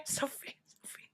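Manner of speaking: a breathy whispered call of a name. Acted. Player calling her lost friend, whispered. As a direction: whisper the name into the dark, searching.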